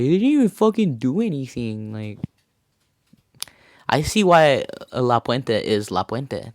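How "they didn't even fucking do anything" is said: higher-pitched mock complaint; protesting heckler. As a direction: imitate a heckler whining in protest. Hecklers protesting police, same imitated voice.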